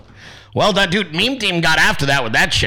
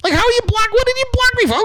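much higher, incredulous whine; mock disbelief. Blocked streamer mimics his own baffled reply, high incredulous whine. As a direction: squeal it like you can't believe you got blocked. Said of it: "I'm like".

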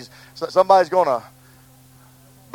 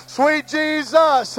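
Sweet Jesus stretched out slowly; slow lingering Sweet Jesus; 9 semitones higher, much slower than usual. Real, not acted. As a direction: draw each word out in worship. Repeats slowly in prayer.

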